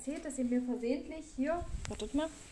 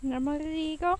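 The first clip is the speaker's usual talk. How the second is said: calling out; 5 semitones higher, 10 dB louder, slower than usual. Real, not acted. Calling the dog.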